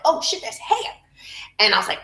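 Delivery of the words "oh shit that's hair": surprised. Retold or imitated.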